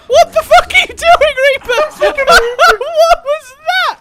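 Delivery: very high, loud laughing squeal; hysterical laughing disbelief. Real, not acted. Player asking what Reaper is doing, shrieking with laughter. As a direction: shriek it while cracking up.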